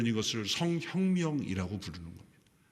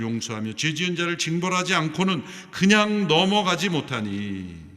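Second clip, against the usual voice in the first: loud, higher proclamation of the verse; preaching at full force; 4 semitones higher, 8 dB louder than usual. Acted. Pastor proclaiming God's words to Moses, loud and high. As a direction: thunder out God's name to the congregation.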